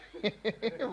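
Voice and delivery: soft greedy chuckle; tickled little laugh; delighted giggle. Acted. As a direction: laugh with pleasure at the treat. Tickled by offer of chaat.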